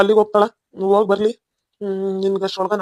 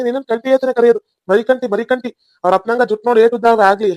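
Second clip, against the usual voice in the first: loud, raised-pitch playful telling-off; mock scolding; 3 semitones higher, 5 dB louder than usual. Teasing a crying baby nephew, loud mock scolding. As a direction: boisterously order a baby to hush.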